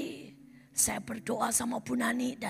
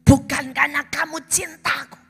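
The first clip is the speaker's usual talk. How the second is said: louder, piercing reproach; stern divine rebuke; 12 dB louder than usual. Acted. God exposing selfish prayers, loud stern rebuke. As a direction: drive it home hard. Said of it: "Tuhan bilang".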